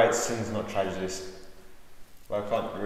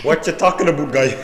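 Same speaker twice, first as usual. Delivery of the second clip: loud, higher silly moose voice; 6 semitones higher, 13 dB louder than usual. Retold or imitated. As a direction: ham up a goofy moose. Moose voice from film.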